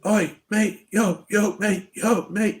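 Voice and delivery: high, loud calling "Oi, mate, yo". Retold or imitated. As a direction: hail a stranger down the street. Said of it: "He starts going".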